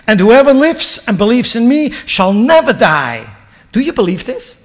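loud, high, pressing NEVER; preaching at full force. Acted. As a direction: proclaim it, then challenge the crowd. Preacher proclaiming Jesus' words.

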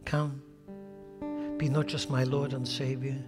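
usual speaking voice

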